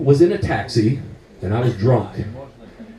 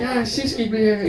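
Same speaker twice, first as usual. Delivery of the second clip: hint of a boozy man rambling; lightly voiced drunk; 5 semitones higher, 4 dB quieter than usual. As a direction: imitate a drunk passenger, pitched up and softened. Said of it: "and then he's like".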